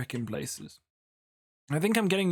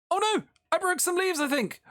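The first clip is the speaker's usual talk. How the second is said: mock alarm; 12 semitones higher than usual. Pretends to be found out.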